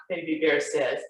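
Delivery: ordinary delivery, nothing marked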